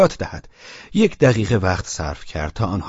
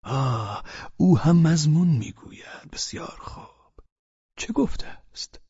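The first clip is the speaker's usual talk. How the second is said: put-on slow, soft old man's reply; calm tone; 6 semitones higher, 5 dB quieter, slower than usual. A narrator's reading. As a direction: respond gently and unhurriedly, softening as you go. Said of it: "با لحنی آرام گفت". (با لحنی آرام و آهسته)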